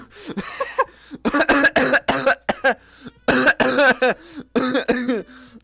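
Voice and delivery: long, loud, high-pitched laughter; real high cackling laugh; loud laughing fit. Real, not acted. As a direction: crack up hard in repeated bursts. Laughing at his own cat calling.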